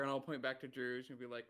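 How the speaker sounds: usual speaking voice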